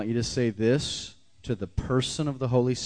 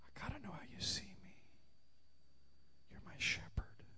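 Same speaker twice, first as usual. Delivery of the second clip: breathy whisper; whispered prayer; 12 semitones higher, 18 dB quieter than usual. Shown as a demonstration. Preacher whispering a prayer to the Spirit. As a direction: barely breathe the words. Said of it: "He's gonna whisper something to you".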